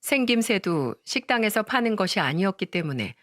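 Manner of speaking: ordinary delivery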